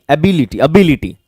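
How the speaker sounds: एबिलिटी as "a-BI-li-ti", with "bi" in the middle; correct pronunciation. Shown as a demonstration. English teacher repeats the right form for students, carefully pronounced. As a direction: say एबिलिटी twice with an "i" after the b. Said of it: "एबिलिटी नहीं".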